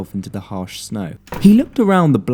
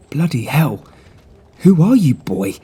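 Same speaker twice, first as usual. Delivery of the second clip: a higher, louder Bloody hell of a stunned posh man; astonished gentleman; 6 semitones higher, 8 dB louder than usual. Acted. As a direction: exclaim in shock at the clever boy. The man voiced.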